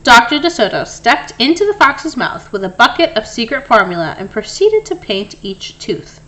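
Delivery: plain reading voice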